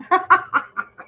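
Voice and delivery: high amused laugh; real laugh. Real, not acted. Vlogger admitting to viewers she hid pajama pants, laughing. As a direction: burst into a high, wordless laugh.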